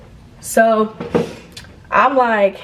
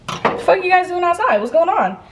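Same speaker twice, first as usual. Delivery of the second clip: higher-pitched, puzzled demand; acting out confused surprise at friends; baffled questioning; 9 semitones higher than usual. Confronting friends found outside.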